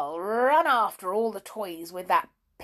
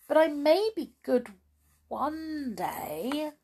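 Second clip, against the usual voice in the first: higher, a touch of meek sincerity; contrite promise; 6 semitones higher than usual. Acted. Amelia Jane promising the toys to be good, meek.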